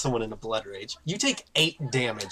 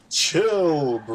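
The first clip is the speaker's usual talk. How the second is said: drawn-out, louder booming call; 4 dB louder than usual. Acted. Game master voices Spog calming the train car, booming. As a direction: let it boom out as if echoing. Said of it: "a booming voice saying".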